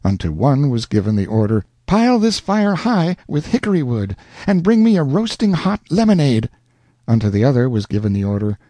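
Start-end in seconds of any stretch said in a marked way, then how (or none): 1.9-6.5 high commanding lady voice. Acted. Mother orders a servant.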